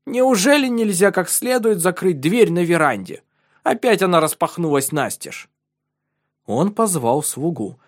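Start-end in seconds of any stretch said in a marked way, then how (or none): 0.1-5.5 irritated complaint. Acted. Count complains about open door.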